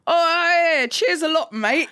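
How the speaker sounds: high, loud chirpy Cockney thanks; mate on the phone to a tradie putting on a chirpy Cockney voice; cheery cockney. Retold or imitated. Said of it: "and he went".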